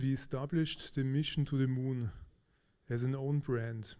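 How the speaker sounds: usual speaking voice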